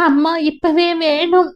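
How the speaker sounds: put-on a small girl pleading with her aunt; pleading little girl. Narrator voices little Kamala. (அம்மாவைப் பார்க்க கெஞ்சும் சிறுமியின் உயர்ந்த குரல்)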